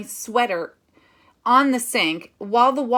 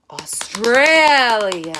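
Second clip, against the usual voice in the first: slow, much louder, drawn out Australia; 3 semitones higher, 10 dB louder, much slower than usual. Shown as a demonstration. Teacher shows the loud peak of the crescendo. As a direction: swell Australia big and loud. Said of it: "And that is a crescendo".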